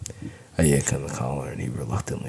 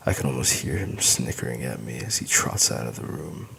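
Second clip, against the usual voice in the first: usual speaking voice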